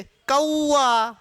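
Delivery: put-on old a-oo glide stretched in कौआ; old pronunciation. Shown as a demonstration. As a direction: say कौआ very slowly with a clear oo. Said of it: "पहले इसको औ बोलते थे".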